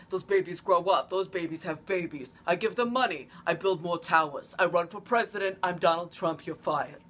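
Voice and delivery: deadpan Trump bragging list; Donald Trump impression. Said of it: "legit doing an impression".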